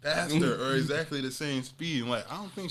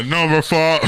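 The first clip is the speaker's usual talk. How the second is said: much louder, higher yelled order; mock shout; 4 semitones higher, 16 dB louder than usual. Mocking his friend's yelled order, loud mock shout. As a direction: bellow it like a drive-thru order.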